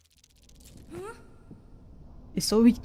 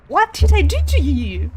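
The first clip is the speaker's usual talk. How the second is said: loud, high outburst with TO leaned on; real frustrated shout; 7 semitones higher, 10 dB louder than usual. Real, not acted. Player repeating her plea to the attacker, loud outburst. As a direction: yell it again, still louder in disbelief.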